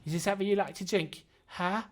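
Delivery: put-on a ragged serving girl hustling for orders; young barmaid voice. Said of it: "arrives at the table going".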